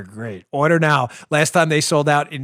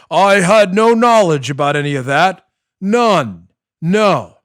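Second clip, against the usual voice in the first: louder, stiff politician denial in a put-on voice; John Kerry impression; 2 semitones higher, 7 dB louder, slower than usual. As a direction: deny everything like a stiff senator. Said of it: "My John Kerry's not nearly as good".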